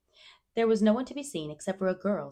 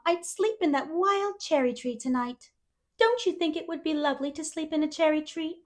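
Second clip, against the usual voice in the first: put-on higher, breathless chatterbox girl; eager young girl; 11 semitones higher, 6 dB louder than usual. A narrator's reading. Anne greeting Matthew at the station, overjoyed. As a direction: gush with delight at being met.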